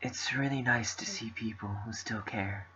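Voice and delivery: quiet, gentle reading of game dialogue; soft character reading. Acted. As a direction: read the lines softly, in character. Reading visual novel dialogue.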